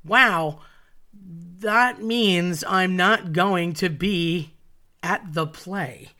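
slow, drawn-out realization; dawning dismay. Retold or imitated. Child realizing she will miss the play, slow dawning dismay. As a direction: stretch each word out as it sinks in.

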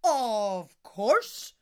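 loud giant agreement; giant shout. Acted. Giant answers Una, mild shout. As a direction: call it out big. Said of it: "shouted Ben and Donna".

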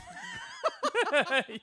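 a very high cackle of a laugh; high shrieking laugh. Real, not acted. Teasing Gus about his hose, a shrieking laugh.

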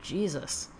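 usual speaking voice

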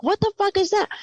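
high, alarmed what-is-that; shocked question. Retold or imitated. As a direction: gape and blurt the question. Son sees something odd outside.

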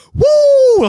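very high, loud, drawn-out woo; hyped-up whoop. Retold or imitated. Man driving home after quitting, elated whoop. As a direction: whoop at full pitch, buzzing with adrenaline. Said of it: "Like I was all G'd up".

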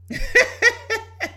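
short, high-pitched laugh; amused laugh. Real, not acted. Woman amused at bearing babies at any age, amused laugh.

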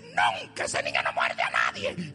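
loud indignant mother, denying everything. Retold or imitated. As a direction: shout her denial back at the pastor. Mother denying her child bites. (madre indignada gritando que su hijo no muerde)

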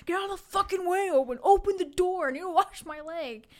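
loud, high panicked shouting; dad shouting at Owen to open the door, loud frantic imitation; frantic yell. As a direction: push past in a mock panic. Germaphobe dad yelling at Owen.

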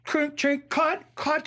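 high-pitched mock yell; retold screaming. Crew halting the take, mock screaming. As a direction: imitate the crew calling a halt. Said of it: "They're screaming".

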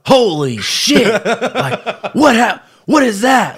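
high, loud alarmed shriek with a laugh; a petty officer recoiling at a swollen knee; freaked-out exclamation. Retold or imitated. Petty officers seeing the knee, shrieking high and loud. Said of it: "they were freaking out".

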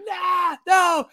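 very high imitated kid's cry; tantruming child. Daughter being scooped up and carried out, high protesting cry. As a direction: mimic a small child shrieking in protest. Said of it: "and she's like".

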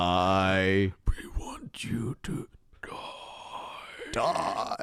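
very slow, eerie whisper; hushed, drawn-out alien menace; Independence Day alien. Retold or imitated. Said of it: "what's the Independence Day line".

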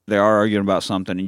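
ordinary delivery

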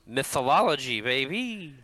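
mock hype. Jokey callback to mispronounced word.

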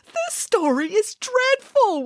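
high, drawn-out wail. A narrator's reading. Boris reacting to the dreadful story, wailing. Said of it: "wailed Boris".

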